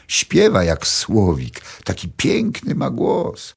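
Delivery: dreamy boasting about a singing son; proud mother. A narrator's reading. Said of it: "mówiła druga". (druga kobieta zachwyca się głosem syna, wolno i wyżej)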